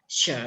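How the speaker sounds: SHE dipped low; third tone. Shown as a demonstration. Teacher modelling Mandarin tones for students, third tone. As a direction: drop she to a low pitch and draw it through. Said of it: "Tone ketiga".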